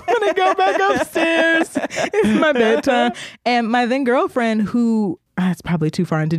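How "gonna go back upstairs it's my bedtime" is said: high, slow, squeaky excuse; mock-childish retreat to bed; awkward escape. Excusing herself from the guys downstairs, high and slow with a laugh.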